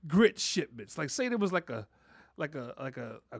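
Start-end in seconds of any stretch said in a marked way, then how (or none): none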